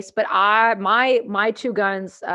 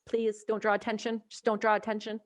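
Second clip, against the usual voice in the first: much softer, sweetly pleading voice; mock polite plea; 6 dB quieter than usual. Gunner asking the Americans to be nice, soft mock politeness. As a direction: ask them nicely, hushed. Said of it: "and I'm like".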